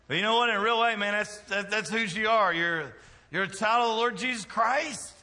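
high, loud, emphatic pulpit delivery; full-force preaching. Real, not acted. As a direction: drive it home like a fired-up preacher.

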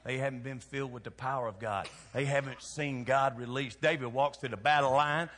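ordinary delivery

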